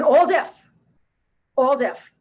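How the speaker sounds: all deaf thrown out high and loud, then again; hammed-up delight over the deaf family; emphatic mock exclamation. Mother's emphatic repetition.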